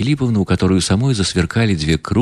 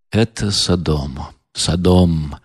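general repeating; 3 semitones lower, much slower than usual. Acted. Генерал в ужасе повторяет, ниже и тише. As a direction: mutter it lower, stunned. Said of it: "повторял генерал".

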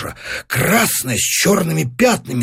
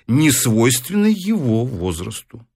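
ordinary delivery

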